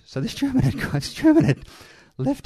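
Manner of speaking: laughing through the words as the joke lands. Real, not acted. Veteran retelling a war anecdote, bursting into laughter.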